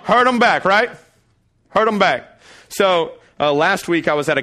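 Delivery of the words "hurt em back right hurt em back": louder, higher, laughing; gut reaction blurted. Acted. Pastor voicing the congregation's gut reaction, loud and laughing. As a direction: blurt out the revenge urge. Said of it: "Come on, what is it?".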